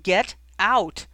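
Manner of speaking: very loud, furious dismissal; explosive command, temper lost; angry yell. A narrator's reading. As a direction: yell to throw her out. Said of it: "he yelled".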